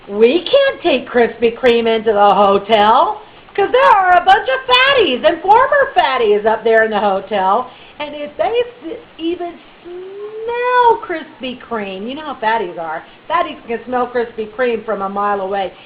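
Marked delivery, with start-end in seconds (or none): 0.1-12.1 alarmed. Retold or imitated. Retold worried plotting.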